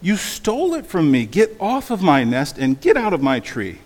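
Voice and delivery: loud accusing tirade, starting high; indignant sputter. A narrator's reading. Bird orders elephant off her nest, angry. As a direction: hurl the accusation, hammering each command. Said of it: "she sputtered".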